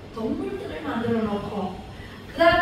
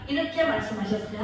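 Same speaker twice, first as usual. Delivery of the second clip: ordinary delivery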